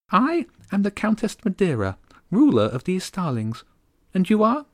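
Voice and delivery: high, haughty little voice; a tiny queen looking down on him; tiny regal bird. Acted. Starling countess greeting a kneeling boy, high and regal. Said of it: "Her voice was tiny and regal".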